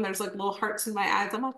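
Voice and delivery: ordinary talk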